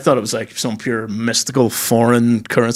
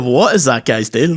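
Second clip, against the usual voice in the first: usual speaking voice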